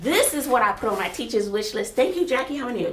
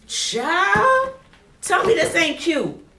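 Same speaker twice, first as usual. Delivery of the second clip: higher, louder gush; 6 semitones higher, 4 dB louder, slower than usual. Real, not acted. Teacher gushing to viewers about a writing tablet. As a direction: sell it like you can't believe it.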